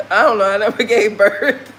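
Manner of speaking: real giggles breaking up the words; amused, laughing mid-sentence; laughing admission. Real, not acted. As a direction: chuckle while owning up to not knowing. Laughing at own ignorance of birth.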